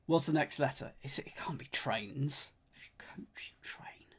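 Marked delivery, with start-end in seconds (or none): 1.0-2.5 whispering. Real, not acted. Whispering to himself over clue. 2.8-4.1 muttering clue under breath; whispering. Real, not acted.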